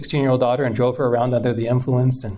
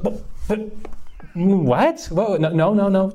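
pitched way up in disbelief, stammering; shocked protest; 6 semitones higher than usual. Retold or imitated. Boys caught by teachers, high shocked stammer. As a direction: caught off guard and pleading. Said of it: "And we're like".

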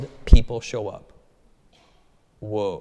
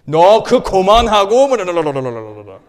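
loud, higher angry Korean father; imitating angry father; 5 semitones higher, 12 dB louder than usual. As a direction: shout it like a dad who has had enough.

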